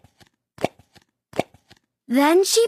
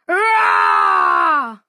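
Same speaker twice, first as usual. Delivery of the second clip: a very deep, loud monster roar, far lower than the narrator; 3 dB louder than usual. Acted. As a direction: roar like a big furry monster to frighten Posy. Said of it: "said the monster".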